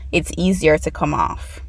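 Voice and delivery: ordinary talk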